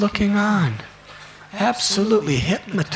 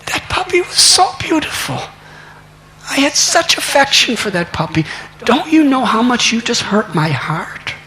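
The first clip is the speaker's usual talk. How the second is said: put-on much higher, pleading child voice; heartbroken plea; 7 semitones higher than usual. Boy telling his mother how she hurt him, high and pleading. Boy grieving lost puppy.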